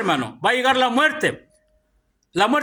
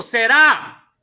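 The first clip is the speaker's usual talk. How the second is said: "será" landing hard on the A; stress on final A; 4 dB louder than usual. Shown as a demonstration. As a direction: hit the final syllable of "será" firmly. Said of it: "también tiene un acento". ("será" recalcando con fuerza la A final)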